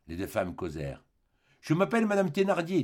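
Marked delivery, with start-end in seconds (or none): 1.6-2.8 higher, brisk innkeeper wife; a landlady sizing up a stranger; Thénardier speaking. Acted. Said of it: "dit la mère des deux petites". (la Thénardier, voix plus aiguë)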